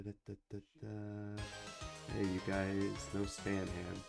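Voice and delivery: normal talking voice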